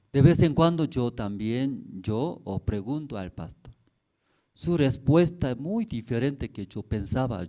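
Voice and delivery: normal talking voice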